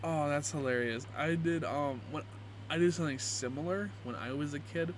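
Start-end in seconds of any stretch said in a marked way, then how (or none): none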